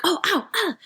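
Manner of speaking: quick high "ow" yelps; high-pitched imitation of being burned; mock yelps of pain. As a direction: act out little stings from spitting grease. Acting out bacon spark pain.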